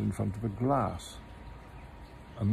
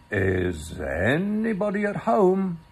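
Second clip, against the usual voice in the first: slow, raised call into a hole; 7 semitones higher, 5 dB louder than usual. Acted. Pooh calling into Rabbit's hole, loudly. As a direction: call down the hole to whoever lives there. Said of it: "put his head into the hole and called out".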